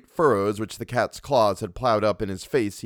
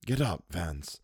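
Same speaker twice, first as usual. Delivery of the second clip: soft, low and gentle; 4 semitones lower, 7 dB quieter, slower than usual. A narrator's reading. Wilde gently telling Vance to rise, soft and low. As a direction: coax him up kindly. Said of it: "in a gentle voice".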